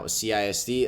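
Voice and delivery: ordinary talk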